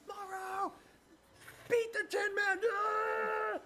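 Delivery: high, shouted crowd cheer; root loudly for Morrow. Acted. Crowd members cheer Morrow on with a high shout.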